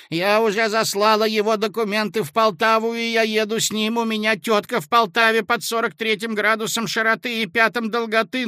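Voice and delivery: high, bright-voiced happy chatter; excited, joyful call. A narrator's reading. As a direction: brunette gushing with delight. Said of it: "крикнула возбуждённо и радостно".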